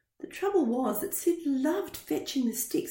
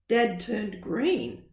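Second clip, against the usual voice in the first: ordinary delivery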